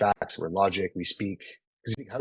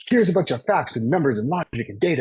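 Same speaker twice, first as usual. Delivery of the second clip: higher, louder mock salesman rattling off facts; mock data-dump pitch; 7 semitones higher, 5 dB louder than usual. Coach mocking a tech salesman, higher and louder data-dump voice. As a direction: parody a salesman burying you in data.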